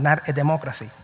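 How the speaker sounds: normal talking voice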